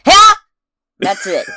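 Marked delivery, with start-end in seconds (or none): 0.0-0.4 high loud imitation of Link's grunt; Link's battle shout. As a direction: bark out a hero's sword yell. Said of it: "all he does is say".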